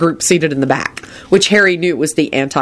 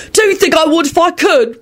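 high-pitched angry yell back; furious retort; 14 semitones higher than usual. A narrator's reading. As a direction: fire back furiously at an accuser. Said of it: "William yelled back furiously".

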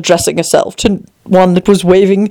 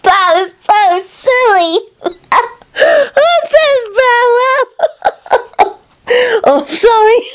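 very high squeaky words broken by laughter; giggling daisy; much slower than usual. Acted. Daisy cracking up at its own joke, giggling. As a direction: squeal helplessly through the giggles.

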